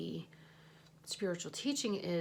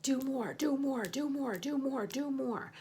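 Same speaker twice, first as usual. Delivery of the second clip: a pushy boss, pitched higher, lightly voiced; mock patriarchy demand; 6 semitones higher, 4 dB louder than usual. Mocking the patriarchy's endless demands, lightly voiced taskmaster. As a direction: chant it like a nagging taskmaster. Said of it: "who was like".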